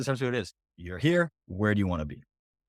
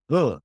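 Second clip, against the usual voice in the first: a short mock groan, a little louder; groan of dismay; 3 semitones higher, 6 dB louder than usual. Coach voicing a client caught without an answer, mock groan. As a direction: let out an imitated ugh of dismay.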